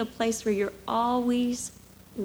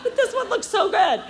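pleading; 8 semitones higher, 5 dB louder than usual. Retold or imitated. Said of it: "I'm like".

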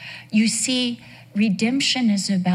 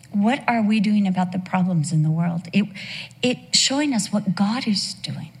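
ordinary delivery

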